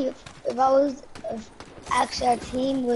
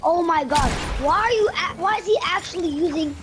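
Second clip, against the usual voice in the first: frustrated yell; 6 semitones higher, 3 dB louder than usual. Real, not acted. Teammate using rocket launcher, yelling.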